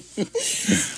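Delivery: breaks into real laughter; burst of laughter. Real, not acted. Laughing after the accent joke.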